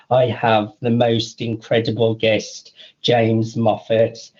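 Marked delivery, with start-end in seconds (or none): none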